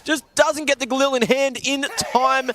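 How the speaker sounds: sudden high excited yell; excited shout. Real, not acted. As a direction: explode with hype on the play. Caster erupts at clutch play.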